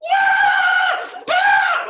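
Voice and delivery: two piercing high shrieks; terrified screams. Retold or imitated. Storyteller imitating the girl on the floorboard, piercing screams. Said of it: "Like that, right?".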